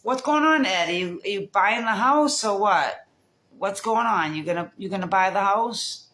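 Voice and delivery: asking Ed across the street about the house, loudly; calling out to Ed. Real, not acted. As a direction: call across to the neighbour, curious.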